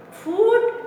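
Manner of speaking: FOOD high, with a long held "oo"; prolonged double O. Shown as a demonstration. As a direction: sing out the long vowel in FOOD. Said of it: "prolonging it, extension of a O".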